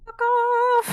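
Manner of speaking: high, loud heavenly shout; angel choir voice. Pretend. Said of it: "an angel choir with you".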